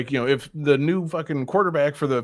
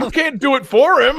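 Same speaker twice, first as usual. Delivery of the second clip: high laughing protest; 13 semitones higher, 10 dB louder than usual. Real, not acted.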